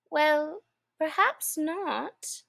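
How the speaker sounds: put-on soft, slow soothing girl; gently calm the mouse. A narrator's reading. Alice soothes the upset mouse softly. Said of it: "said Alice in a soothing tone".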